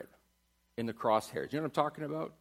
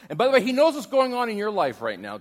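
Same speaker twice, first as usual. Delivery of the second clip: fast, louder and higher; rapid emphatic sermon line; urgent loud preaching; 9 semitones higher, 11 dB louder, faster than usual. Acted. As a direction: press it home to them.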